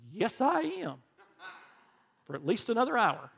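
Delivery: high, laughing reply; a laughing mock yes; laughing joke. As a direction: laugh through the punchline. Joking answer on a form.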